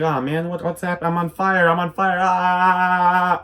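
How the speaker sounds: hammed-up panic building to a cry; mock panic. Ben shouting as he catches fire in panic.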